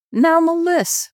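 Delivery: high chuckling fatherly teasing; chortling father. A narrator's reading. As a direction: chortle it, eyes twinkling. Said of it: "my father chortled".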